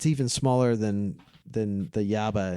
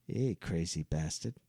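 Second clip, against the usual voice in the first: imitating the driver; 7 semitones lower, 10 dB quieter than usual. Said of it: "random driver looks at him and says".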